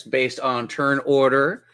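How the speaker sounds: ordinary talk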